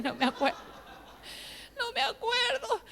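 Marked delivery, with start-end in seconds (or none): 1.8-2.8 high, slow whine with laughter; mock tearful wail. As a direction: wail it helplessly, half laughing. Repeats it as a comic wail.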